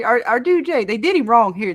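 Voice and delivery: ordinary talk, nothing marked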